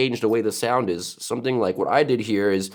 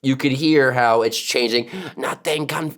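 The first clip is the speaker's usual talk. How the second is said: ordinary talk, nothing marked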